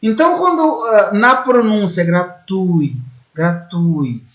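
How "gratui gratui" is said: gratui with the U standing out and the I gliding after it; slow gra-TÚI, no break between U and I; stress on the U. Shown as a demonstration. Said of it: "o U se destacando". (gratui com o U em destaque, I semivogal)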